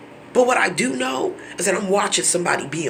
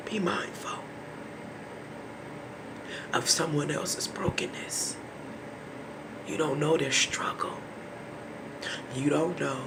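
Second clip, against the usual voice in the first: hushed, much softer plea; a soft solemn plea to the audience; solemn whisper; 12 dB quieter than usual. Real, not acted. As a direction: whisper it gravely.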